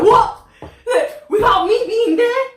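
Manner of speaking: high, loud, laughing mock shock; shocked outburst. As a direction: gasp it out as the manager in disbelief. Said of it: "She's like".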